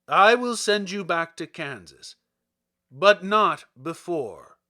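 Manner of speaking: booming wizard Oz. Acted. The great head sets Dorothy's price, loud and commanding. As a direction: lay down the terms like an all-powerful wizard. Oz demands the witch's death.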